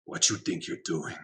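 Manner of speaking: a hint of a made-up South American accent, calling a creature; playfully addressing Utsal in a loose South American accent; vague South American accent. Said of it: "I don't know what that accent is. Maybe vaguely South American".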